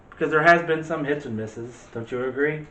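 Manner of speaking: ordinary delivery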